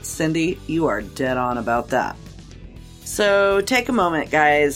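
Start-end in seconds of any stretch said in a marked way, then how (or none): none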